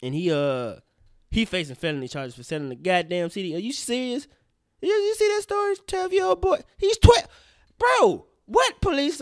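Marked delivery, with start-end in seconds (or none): 4.8-9.2 higher and louder, outraged disbelief; can you believe this, loud and high. Real, not acted. Telling Shay about the arrested boy, outraged and high-pitched.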